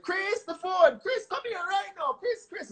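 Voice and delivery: high, eager call up the stairs; excited mom calling. Retold or imitated. Imitating his mom calling him to the phone, excitedly high. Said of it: "a little bit of excitement".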